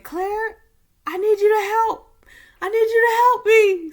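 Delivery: high, loud urgent call to her daughter; mother yelling for Claire to come help; mom calling out. Retold or imitated. Mom calls Claire inside, high loud urgent call.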